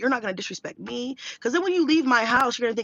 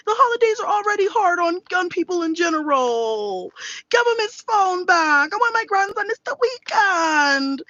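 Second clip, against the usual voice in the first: pleading grandma; 10 semitones higher, 4 dB louder than usual. Retold or imitated. Grandma begs leniency because it's the holidays, mock pleading. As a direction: plead like an indulgent grandma, hammed up.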